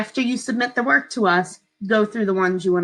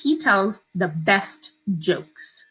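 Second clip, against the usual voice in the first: slow, clear dictation; dictating; slower than usual. Shown as a demonstration. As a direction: spell it out slowly for kids. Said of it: "I will read you one and two again".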